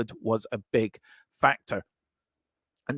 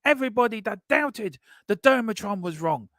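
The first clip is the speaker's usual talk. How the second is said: crowing mock announcement; 12 semitones higher than usual.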